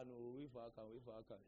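ordinary talk, nothing marked

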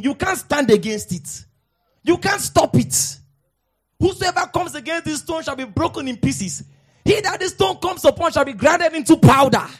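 loud, rising preacher's declaration; full-force preaching. Real, not acted. Preacher warning enemies of the gospel, loud and rising.

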